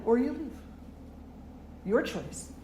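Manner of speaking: high start, falling to a matter-of-fact close; blunt ultimatum. Retold or imitated. Friend leaving it up to the man, blunt ultimatum. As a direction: imitate a take-it-or-leave-it ultimatum. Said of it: "And he was just like that".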